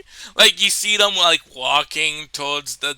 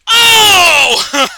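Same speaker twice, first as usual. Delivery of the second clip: loud, high comic holler; hammed-up cry of discovery; mock exclamation; 14 semitones higher, 11 dB louder than usual. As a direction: shout it out long and high. Acts out TSA lady's reaction.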